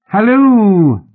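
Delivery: high, loud, long halloo; boy's shrill holler; shrill hail. A narrator's reading. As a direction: call shrilly from high above. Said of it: "came a shrill voice from above".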